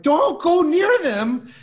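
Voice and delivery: high, slow, pleading cry to mom; frantic plea. Retold or imitated. Son begging his mom to stay away from the scary men, high and slow. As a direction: beg her not to go, drawn out high.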